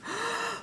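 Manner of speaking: sharp high gasp; a startled intake of breath. Acted. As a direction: gasp in shock. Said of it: "he gasped".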